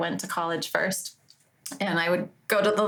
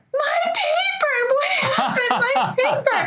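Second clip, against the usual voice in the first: high, loud hammed-up wail with laughter; fake panic; 6 dB louder, slower than usual. Covering her guilt in the computer lab, hammed-up fake wail. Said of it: "I stood up and I went".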